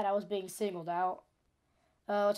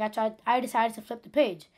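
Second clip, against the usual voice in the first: normal talking voice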